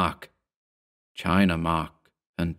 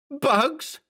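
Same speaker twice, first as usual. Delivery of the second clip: very high shrieked question at moderate volume; almost scream it in disbelief; high-pitched incredulous squawk; near scream. A narrator's reading. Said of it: "Mark practically screamed".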